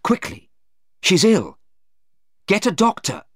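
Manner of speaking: high, loud cry for help; urgent alarm. Acted. Anna calling a man to fetch a doctor, alarmed. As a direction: call out in sudden panic.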